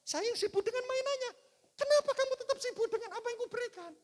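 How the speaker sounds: high, loud mock complaint; the father's exasperation, voiced high and loud; exasperated father. The father complains loudly about the busy child, high and exasperated. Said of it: "Dan saya sempat jengkel".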